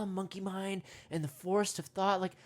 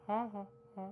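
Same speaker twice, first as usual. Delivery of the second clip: soft monkey laugh; 7 dB quieter, faster than usual. Pretend. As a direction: hoot quietly like a distant monkey. Monkey answers goodbye softly.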